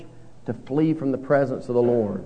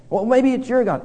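another sailor; 8 semitones higher than usual. Retold or imitated. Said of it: "international crew".

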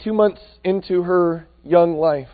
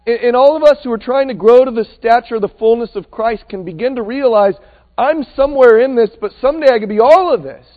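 fervent preaching; 6 semitones higher, 7 dB louder than usual. Acted. Preaching at full force.

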